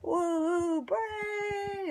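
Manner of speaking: high, drawn-out whooping cheer; mock loud cheer. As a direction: whoop it out very high like a proud spectator. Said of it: "clapped as loud as I could".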